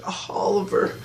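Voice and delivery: high, airy mock lament; mock sad. Reviewer mocking sad Felicity, high plaintive sigh. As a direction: sigh the name in imitation heartbreak. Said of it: "she's all sad".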